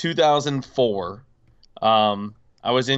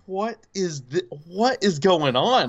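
higher-pitched, bewildered mock panic; retold freak-out; 5 semitones higher than usual. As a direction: act out freaking out at the news. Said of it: "I'm freaking out".

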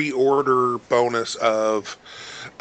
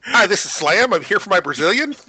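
high, loud mock Slam; playful voiced Slam; 10 semitones higher, 5 dB louder than usual. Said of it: "Yes, exactly".

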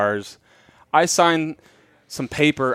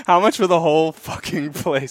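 laughing while quoting; 3 semitones higher than usual. Retold or imitated. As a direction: quote said through laughter. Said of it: "He said it".

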